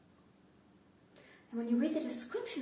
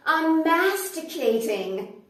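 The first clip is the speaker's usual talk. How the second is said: slow and louder, with a smug smile; proud announcement; 5 semitones higher, 8 dB louder, much slower than usual. Retold or imitated. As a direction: savour each syllable proudly. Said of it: "he would smile very proudly and say".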